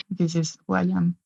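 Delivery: normal talking voice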